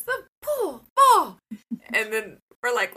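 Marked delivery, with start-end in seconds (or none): 0.0-1.4 put-on high, slow little-kid voice; voicing a small child; toddler voice. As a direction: imitate a toddler slowly. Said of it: "And he goes".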